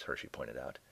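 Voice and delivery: plain reading voice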